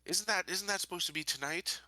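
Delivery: ordinary delivery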